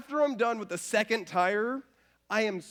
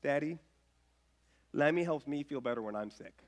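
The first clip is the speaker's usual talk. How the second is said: put-on sweet child voice; 6 semitones lower, 5 dB quieter than usual. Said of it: "she goes".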